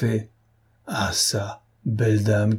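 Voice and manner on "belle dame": plain reading voice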